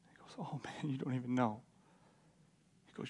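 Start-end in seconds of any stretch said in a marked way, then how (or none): 0.4-1.6 very soft, half-whispered start; hushed awe. Acted. Timothy about to give Paul good news, hushed. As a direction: say it low, as if overwhelmed by the news.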